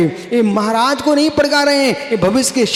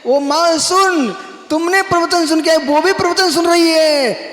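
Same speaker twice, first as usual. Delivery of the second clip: mock shout of a child to his mother, raised and higher; a child's raised call to his mother; 7 semitones higher, 3 dB louder than usual. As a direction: call out to mom like a kid.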